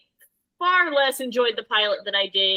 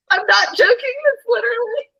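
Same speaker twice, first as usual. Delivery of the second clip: laughing; 12 semitones higher than usual. Real, not acted. Laughing fit mid-sentence.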